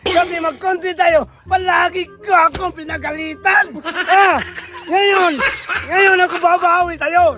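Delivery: acted angry tirade; galit na galit. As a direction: threaten payback in a worked-up rant. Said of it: "Galit na galit na talaga ako". (galit na pagbabanta)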